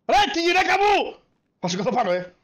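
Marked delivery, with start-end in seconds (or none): 0.1-1.2 high, slow, outraged cry; shocked outcry. Acted. Robber crying out in shock about his wife. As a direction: yelp it out in disbelief.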